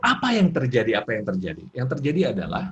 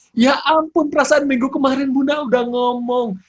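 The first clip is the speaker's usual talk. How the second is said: high, loud mock scolding; exasperated mother; 11 semitones higher, 6 dB louder than usual. Shown as a demonstration. Mother repeating last week's scolding, hammed-up exasperation. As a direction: nag with exaggerated disbelief.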